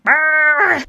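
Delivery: sudden loud, high bellow; Anthony Hopkins screaming. Acted. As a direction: roar it out as a mock scream. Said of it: "it's just Anthony Hopkins screaming".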